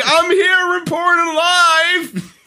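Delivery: high, drawn-out shout; hammed-up live-report holler; mock yelling. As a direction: yell it like a reporter over plane noise. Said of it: "Can you just yell".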